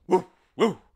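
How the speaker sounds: high, very loud imitated woofs; dog barking thanks. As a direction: bark like a happy dog. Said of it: "barked the dog".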